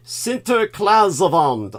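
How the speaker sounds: Sinterklaasavond said slowly the Dutch way, SIN-ter-klahs-ah-vont; slow careful Dutch Sinterklaasavond; Dutch word. Shown as a demonstration. Host naming the Dutch holiday, careful Dutch sound. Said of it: "in English".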